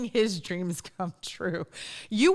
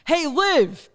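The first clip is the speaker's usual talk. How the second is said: Italian Jersey dad, raised and loud; father impression; 6 semitones higher, 5 dB louder than usual. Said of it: "when I impersonate my father".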